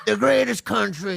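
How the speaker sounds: lightly voiced Trump, back of throat; a hint of Trump rally voice; Trump impression. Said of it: "A little bit".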